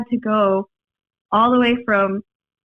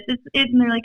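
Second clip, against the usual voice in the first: normal talking voice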